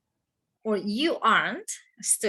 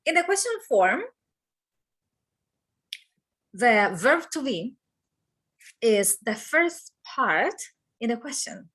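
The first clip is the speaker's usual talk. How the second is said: ordinary talk, nothing marked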